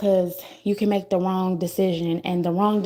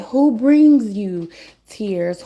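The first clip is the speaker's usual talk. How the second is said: louder, very slow, each word weighed; slow pointed question; 5 dB louder, much slower than usual. Real, not acted. To her viewers, a slow pointed question. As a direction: lean on it, make them think.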